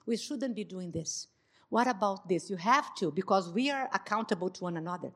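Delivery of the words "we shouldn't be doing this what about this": soft, calm, loving rebuke; soft and tender, not accusing; gentle correction. Shown as a demonstration. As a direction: correct him gently and kindly. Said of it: "you have all right to say".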